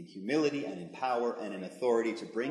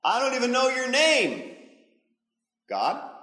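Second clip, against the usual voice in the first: doubtful Moses; 11 semitones higher, 8 dB louder than usual. Acted. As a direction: push back at God in a raised voice. Moses voiced at burning bush.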